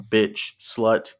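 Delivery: normal talking voice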